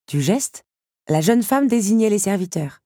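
plain reading voice

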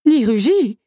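high, loud exclamation; astonished. A narrator's reading. Stunned servants add to the wonder. (stupéfaction, voix haute et forte)

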